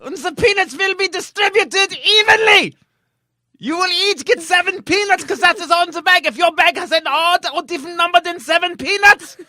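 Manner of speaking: a strict German flight attendant shouting commands. Acted. German stewardess shouts orders at passengers, German accent. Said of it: "German flight attendant".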